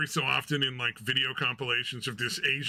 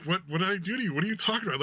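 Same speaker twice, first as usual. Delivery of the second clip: higher, puzzled pleading; confused streamer; 6 semitones higher, much faster than usual. Retold or imitated. Streamer asking the paranoid lady what she did, higher. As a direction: ask what you did wrong, higher. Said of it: "She's like".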